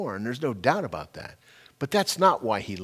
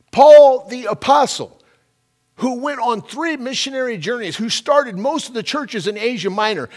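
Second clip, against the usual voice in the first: raised, high-pitched preacher's voice; impassioned preaching; 6 semitones higher, 9 dB louder than usual. Real, not acted. Preacher urging the congregation to get this in their hearts, impassioned preaching. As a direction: drive the point home to the congregation with bursts of volume.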